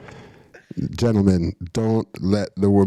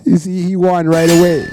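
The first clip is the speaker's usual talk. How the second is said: higher, louder and emphatic; excited exclaim; 9 semitones higher, 7 dB louder than usual. Real, not acted. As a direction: rub it in with glee. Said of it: "excited reaction to the story".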